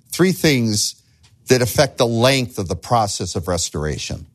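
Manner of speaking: normal talking voice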